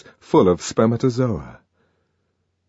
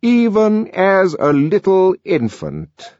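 raised, slow, pompous director voice; stern director; 11 semitones higher, 4 dB louder, slower than usual. A narrator's reading. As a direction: address the room like a severe official.

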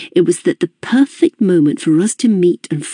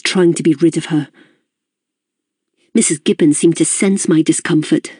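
plain reading voice

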